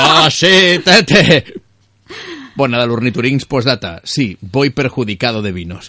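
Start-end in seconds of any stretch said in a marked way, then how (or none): 0.0-1.5 trying not to laugh. Real, not acted. Laughing at listener's message.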